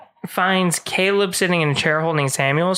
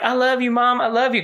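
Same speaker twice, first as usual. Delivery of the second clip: pleading daughter; 6 semitones higher than usual. Retold or imitated. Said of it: "Thomason's like".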